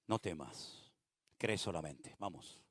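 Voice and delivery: soft, low soothing voice; calm Jairo down tenderly; Jesus softly comforting the frightened father; gentle reassurance. Acted.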